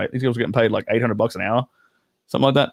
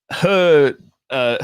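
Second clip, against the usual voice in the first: ordinary talk, nothing marked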